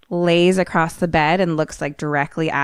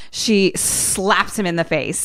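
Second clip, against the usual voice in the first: ordinary delivery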